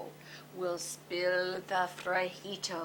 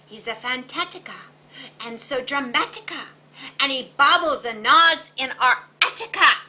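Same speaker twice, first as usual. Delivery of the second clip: dramatic gossip; 5 semitones higher, 9 dB louder than usual. A narrator's reading. Describing the nodding Bobolito.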